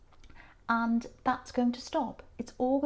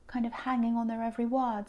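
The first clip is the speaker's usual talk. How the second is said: normal talking voice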